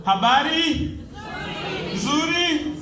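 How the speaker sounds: normal talking voice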